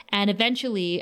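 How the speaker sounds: ordinary delivery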